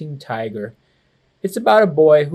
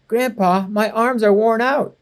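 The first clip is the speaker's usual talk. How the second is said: put-on higher whiny kid complaint; complaining; 6 semitones higher than usual. Boy complaining to grandpa about sore arms, whiny. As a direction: whine it like a tired child. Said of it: "I complained".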